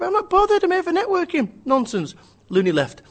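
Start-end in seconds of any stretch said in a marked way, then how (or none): 0.0-1.5 high, loud exasperated outburst. Acted. Networker fed up with bruschetta talk, high exasperation. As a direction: protest as if fed up with small talk.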